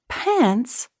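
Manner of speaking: high, incredulous question; shocked echo. A narrator's reading. Elizabeth echoing her husband in disbelief, shocked. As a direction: repeat it as if you misheard.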